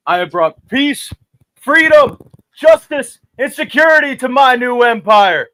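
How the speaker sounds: rising to a dramatic shout. Acted. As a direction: declare it loud and high. Anakin's climax line.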